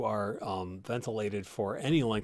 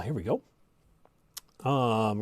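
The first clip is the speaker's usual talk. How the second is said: ordinary talk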